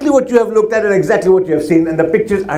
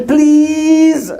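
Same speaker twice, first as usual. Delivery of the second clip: put-on higher, pleading child's plea; child begging; 7 semitones higher, much slower than usual. Kid begging mom and dad for permission, pleading. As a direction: beg like a kid asking a parent. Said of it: "it's always".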